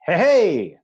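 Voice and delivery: loud, high "Hey, hey!"; excited shout; excited exclamation. Shown as a demonstration. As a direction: call out excitedly. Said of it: "you get very excited about something".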